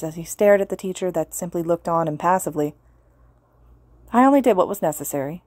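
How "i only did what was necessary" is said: usual speaking voice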